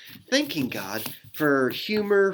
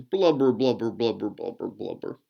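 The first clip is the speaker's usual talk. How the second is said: mock blubbering; 4 semitones lower than usual. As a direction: blubber like a bear crying itself out. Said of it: "the bear blubbers on".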